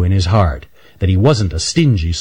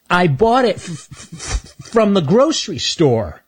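high voice catching on the f, stammering; Stanley stammers out his excuse; stuttering squirrel; 10 semitones higher than usual. Acted. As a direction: get stuck on the f before it comes out. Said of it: "Stuttering Stan".

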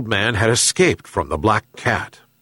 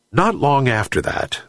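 plain reading voice

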